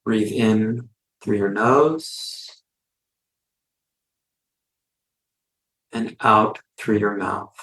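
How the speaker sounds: unhurried phrases with long silences between; guided meditation. Real, not acted. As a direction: lead listeners gently through each breath, in and out.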